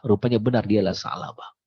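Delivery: ordinary delivery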